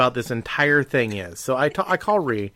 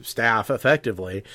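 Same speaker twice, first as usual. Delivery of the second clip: ordinary delivery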